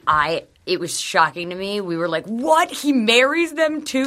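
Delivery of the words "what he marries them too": high, slow mock shock at the news; shocked disbelief. Friends reacting to a Chris Harrison montage, in high mock shock. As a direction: gasp it out like you just heard he marries them.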